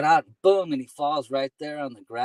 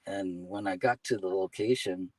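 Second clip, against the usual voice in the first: ordinary talk, nothing marked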